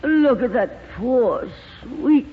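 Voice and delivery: high, slow, swooning theatrical gush; gushing glamorous actress. Acted. Lorraine fussing over Sheridan's tortured face, gushing and high. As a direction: coo over him like a diva.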